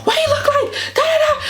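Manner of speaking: very high, squealy panicked questions; imitating freaking-out mom. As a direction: mimic a mother in a hammed-up tizzy. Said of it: "my mom's freaking out".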